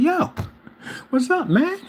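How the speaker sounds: high, lively hail; retold greeting. Hailing the neighbor working on his car, high retold greeting. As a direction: call over to a buddy in a high voice.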